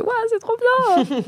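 high, slow, thrilled gasp of delight; excited inner thought. Retold or imitated. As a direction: gush like a starstruck fan. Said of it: "donc je me disais".

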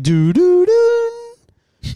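sung cabin chime climbing very high; loud, rising three-note "doo"; imitated intercom chime. Pilot coming on the intercom, imitated chime. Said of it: "and it's like".

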